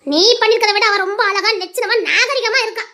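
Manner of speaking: ordinary talk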